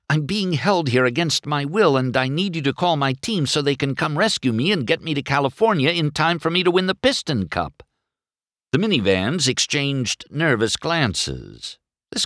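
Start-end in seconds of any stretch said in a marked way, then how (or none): 0.1-7.8 high-pitched, hurried rush; urgent plea. A narrator's reading. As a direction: blurt out a plea for rescue. Desperate plea to be rescued.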